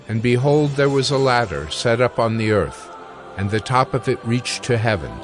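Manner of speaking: ordinary talk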